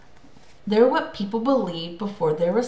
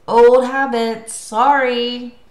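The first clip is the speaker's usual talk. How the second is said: loud, drawn-out call, pitched higher; yelling; 5 semitones higher, 9 dB louder, much slower than usual. A narrator's reading. Mister D apologises to the sky, yelling. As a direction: yell a slow apology upward. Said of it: "looked at Scott and yelled".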